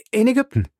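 high-pitched wonder; astonished question. Acted. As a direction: marvel at where you have landed. Passepartout marvels at where he is.

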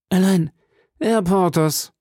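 usual speaking voice

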